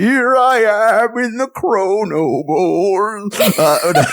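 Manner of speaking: put-on high slow quavery old man; shaky old voice. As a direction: drag it out like a dazed old man. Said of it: "the old man voice".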